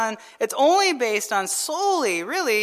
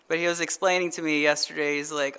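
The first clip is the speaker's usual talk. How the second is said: ordinary delivery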